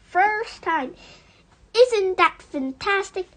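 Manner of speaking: gleeful boast; proud excitement. Acted. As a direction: crow about your own cleverness. Fox boasting delighted.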